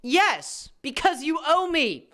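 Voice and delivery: high-pitched, emphatic comeback; mock insistent reply. YouTuber voicing the exploiter answering back, insistent. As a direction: jump high on the yes and insist.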